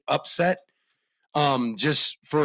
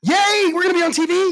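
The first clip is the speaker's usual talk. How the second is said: high thrilled squeal; excited; 14 semitones higher, 5 dB louder than usual. Real, not acted. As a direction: gush with delight. Excited about being on TV.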